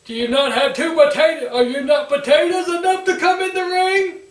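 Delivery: hammed-up Roman Reigns mocking Sheamus. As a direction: taunt him like a smug bully. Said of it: "Roman's response was".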